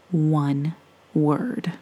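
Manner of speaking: plain reading voice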